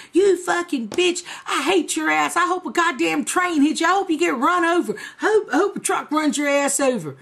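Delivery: high-pitched retold rant, piling on curses; imitated furious tirade; angry cussing out. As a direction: act out his hateful letter with rising heat. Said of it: "that motherfucker was cussing me out".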